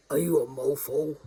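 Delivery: low, loud comic voice; funny put-on accent. Winding up the scam caller, low loud funny voice. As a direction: ask it in a silly booming low accent. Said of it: "I just put on an accent".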